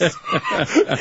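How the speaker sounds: high squealing cackle; shrieking laugh. Real, not acted. Remembering the slap, shrieking with laughter. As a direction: let out a shrill laugh.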